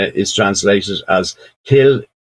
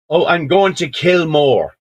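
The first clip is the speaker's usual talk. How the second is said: lightly acted young fella, higher-pitched; young fella answering; 7 semitones higher than usual. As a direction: answer innocently in a raised voice. Young fella answers soldier in story.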